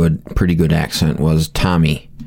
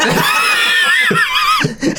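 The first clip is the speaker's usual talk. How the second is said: very high shrieking laugh. Real, not acted. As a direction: let out a squealing laugh. Big laugh.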